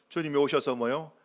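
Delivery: ordinary talk, nothing marked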